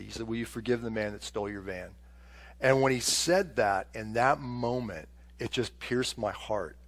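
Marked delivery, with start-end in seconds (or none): 0.3-1.9 soft, hushed and merciful; gentle voice of the Lord. Retold or imitated. God gently asking Bob to forgive the thief. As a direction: ask it tenderly, as a quiet mercy. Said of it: "he just spoke to me, just his mercy".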